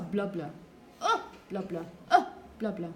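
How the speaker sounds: low, mumbled "blah, blah" broken by punched "uh"; exaggerated stress-timed rhythm. Shown as a demonstration. Teacher exaggerating the rhythm of English, mumbled "blah" between stressed beats. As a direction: punch each "uh" and swallow each "blah". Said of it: "I'm exaggerating, but this is how".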